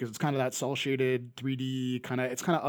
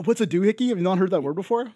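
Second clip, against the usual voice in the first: higher, louder disbelief; surprised; 6 semitones higher, 7 dB louder than usual. Real, not acted. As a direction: echo the question back in real surprise. Incredulous at co-host's unfamiliar word.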